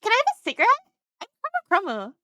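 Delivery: light imitation, high start dropping at the name; Chromo. Retelling how she walked up, light impression. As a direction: mimic a cheeky request for a smoke. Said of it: "She just walked up and went".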